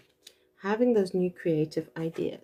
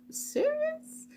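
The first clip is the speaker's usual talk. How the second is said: incredulous; 5 dB quieter than usual. Retold or imitated. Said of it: "And I'm just like".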